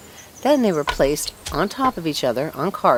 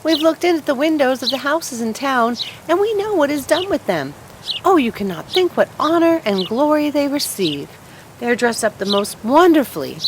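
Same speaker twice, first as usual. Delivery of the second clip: high, bright bird chatter, louder; chattering sparrows; 6 semitones higher, 3 dB louder than usual. Acted. Sparrows telling the fir tree about town, high chatter. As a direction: gossip excitedly like little birds. Sparrows describe Christmas trees.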